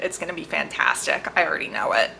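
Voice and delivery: ordinary talk, nothing marked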